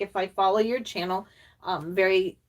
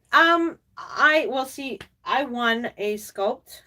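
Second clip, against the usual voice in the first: ordinary talk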